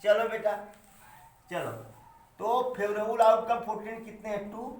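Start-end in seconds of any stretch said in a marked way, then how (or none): none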